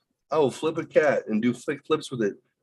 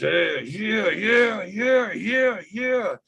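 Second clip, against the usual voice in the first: rising, higher and higher yeahs; hyped chant of yeahs, going way up; egging on the cat; 11 semitones higher, much slower than usual. Retold or imitated. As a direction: pump the cat up, climbing in pitch. Egging the cat on while roughing it.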